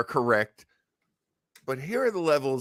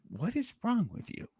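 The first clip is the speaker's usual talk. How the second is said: high exasperated question; 11 semitones higher, 7 dB quieter than usual. Retold or imitated. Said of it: "And I said".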